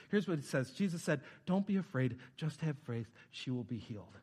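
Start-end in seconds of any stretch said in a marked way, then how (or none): 1.4-4.2 low, soft, gentle reassurance; loving, compassionate. Retold or imitated. Jesus comforting a father who fears for his daughter, compassionate. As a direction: comfort tenderly, sinking softer with each phrase. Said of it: "loving, compassionate words from Jesus".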